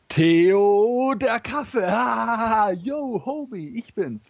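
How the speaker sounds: high, drawn-out comic call dissolving into laughter; whooping punchline. Acted. Engels revealing himself to his pal at the door, whooping. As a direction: crow the joke in a high, elated voice. Delivers knock-knock punchline, then cheers.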